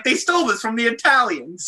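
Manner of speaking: Jersey Italian wiseguy, high and loud; Paulie Walnuts. Acted. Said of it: "I can do that line in that voice".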